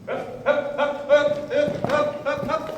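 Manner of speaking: loud, high urging calls; calling out. Real, not acted. Trainer urging his bucking mare forward, loud high calls. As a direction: shout it up to keep her moving.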